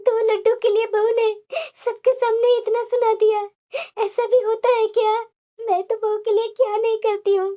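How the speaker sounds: crying lament with sobbing breaths; tearful hurt. Acted. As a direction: pour out your humiliation through tears. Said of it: "तुम्हारी आंखों में आंसू क्यों है". (रोते हुए, आहत स्वर में)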